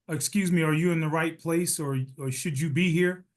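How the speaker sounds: ordinary delivery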